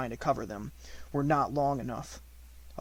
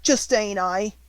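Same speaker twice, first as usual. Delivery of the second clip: high, loud hoarse urchin; a rough ragged beggar child; hoarse street urchin; 7 semitones higher, 8 dB louder, slower than usual. A narrator's reading. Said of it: "she said in a hoarse voice".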